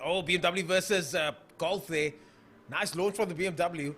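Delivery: projected drag race announcer delivery; live race call. Real, not acted. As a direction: call the launch and times to the crowd.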